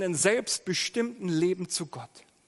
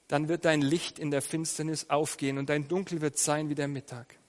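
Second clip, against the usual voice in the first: ordinary delivery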